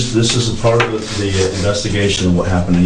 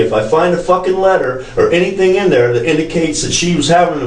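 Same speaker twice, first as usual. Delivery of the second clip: raised, hard and pitched-up, slowing to hammer points; angry interrogator; 10 semitones higher than usual. Real, not acted. Detective confronts the suspect, angry and raised. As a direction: lays into him, daring him to lie.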